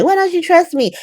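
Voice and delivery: high, incredulous mock whine; mock indignation. Mocking Willow's question to Michael, high mock whine. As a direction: parody her wounded disbelief. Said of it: "her being like".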